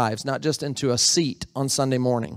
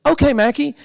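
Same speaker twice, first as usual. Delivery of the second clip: bright, eager yes-man voice, higher and louder; sweet compliant reply; 9 semitones higher, 5 dB louder than usual. Retold or imitated. Pastor voicing Dimitri agreeing to fetch water, chirpy. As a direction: play the obedient helper cheerfully. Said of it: "and he goes".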